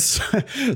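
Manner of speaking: sudden high-pitched laugh. Real, not acted. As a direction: burst out laughing mid-reading. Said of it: "Sorry for laughing".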